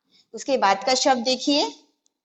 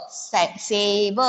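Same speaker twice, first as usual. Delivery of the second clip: से then सेब drawn out slowly; slow syllable demo; 2 semitones lower, much slower than usual. Shown as a demonstration. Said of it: "स पे ए की मात्रा से".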